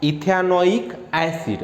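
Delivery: very slow ethanoic acid, each syllable stretched. Shown as a demonstration. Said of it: "क्या हुआ". (और धीरे ethanoic acid)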